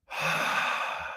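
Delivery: long relieved exhale, imitated; heavy, slow sigh of release; big deep breath. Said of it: "take these big, deep breaths".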